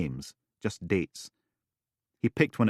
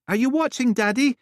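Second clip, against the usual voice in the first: put-on excited little girl; 7 dB louder than usual. Lucy calling.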